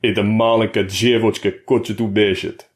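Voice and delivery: mock him to the crowd with a sneer; sneering Russian. A narrator's reading. Boris mocking Colt to the jeering inmates, sneering. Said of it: "He sneered as he said it".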